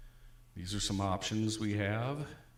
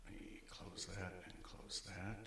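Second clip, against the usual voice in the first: whispered aside; 14 dB quieter than usual. Real, not acted. Muttering aside while setting up.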